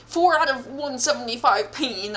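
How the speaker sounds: normal talking voice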